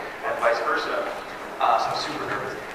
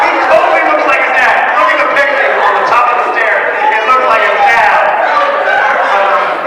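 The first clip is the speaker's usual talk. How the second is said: high, loud, worked-up protest; heated rant; 9 semitones higher, 5 dB louder than usual. Real, not acted. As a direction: rant it like a fan who's appalled. Heated rant about the ship.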